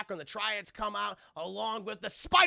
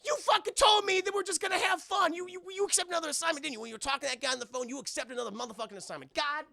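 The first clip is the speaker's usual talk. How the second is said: much higher, fast mock rant; Chris Tucker; 8 semitones higher, 5 dB louder, faster than usual. Chris Tucker ranting at Jackie, higher fast mock anger. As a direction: rant at him in betrayal. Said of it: "And Chris Tucker's like".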